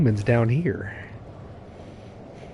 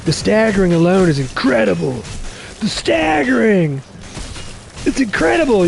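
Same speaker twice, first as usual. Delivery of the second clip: awed streamer reaction; amazed gush; 7 semitones higher than usual. Real, not acted. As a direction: marvel at it, stretching the staggering. Marvels at enemy staggering.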